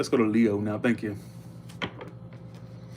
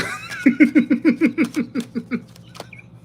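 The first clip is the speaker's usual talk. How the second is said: high laugh; 14 semitones higher, 6 dB louder than usual. Real, not acted. Laughing at own joke.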